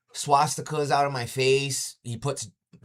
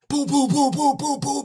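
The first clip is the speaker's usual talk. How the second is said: much higher, louder booms, hammered out; imitated door pounding; 8 dB louder than usual. Dad pounds on the door, mimicked in loud booms. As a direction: bang out each boom like a fist on a door.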